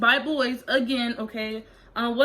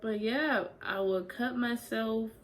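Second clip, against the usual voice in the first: choked up; 10 dB quieter than usual. Real, not acted. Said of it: "if I'm choked up about this".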